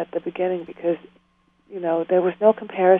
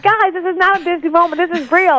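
high, loud, sing-song earnest mom; exaggerated, very high-pitched motherly pleading; Disney mom; 12 semitones higher, 7 dB louder than usual. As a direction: lecture the kids like a cartoon mother. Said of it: "my children say I sound like a Disney mom".

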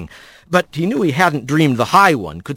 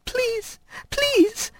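tearful begging; 8 dB quieter than usual. Acted. Child begging in tears.

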